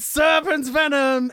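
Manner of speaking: loud, high, slow shouted title; excited shout. Retold or imitated. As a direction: belt it out like a fan. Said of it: "Kenneth Prytha just the other day just sent us".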